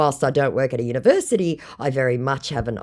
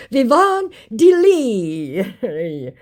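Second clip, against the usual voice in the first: mock grand announcement; 9 semitones higher, 5 dB louder, much slower than usual. Mocking the scam name.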